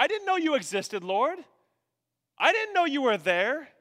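high, loud mock-innocent plea; feigned innocence. Sinner making excuses to the Lord, feigned innocence. Acting someone excusing themselves to God.

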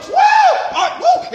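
high, loud bursts of joy; joyful whooping. Acted. Healed leper realising he is healed, joyful whooping. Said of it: "Why all ten of them didn't go".

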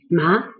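ma swept up at the end; rising second tone. Shown as a demonstration. Teacher naming numbness from the seasoning, rising tone. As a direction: finish on ma with an upward glide. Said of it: "It's a rising tone".

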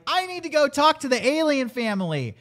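high, insistent declaration; hammed-up high resolve; mock compelled kid. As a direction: imitate a kid set on his mission. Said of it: "he feels compelled".

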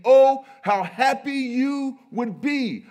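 very loud, high, drawn-out proclamation; emphatic Jesus, pealing over the crowd; most emphatic voice. Acted. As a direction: cry it out slowly with full force. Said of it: "In his most emphatic voice".

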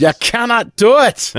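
high, loud laughing shout of the line; laughing mock Scotty cry. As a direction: blurt it out in a laughing Scottish engineer voice.

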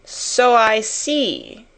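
very slow, drawn-out scorn; withering. A narrator's reading. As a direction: stretch it out witheringly. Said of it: "said Miss Minchin witheringly".

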